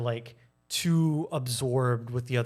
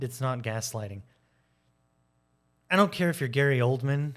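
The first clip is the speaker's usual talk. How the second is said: normal talking voice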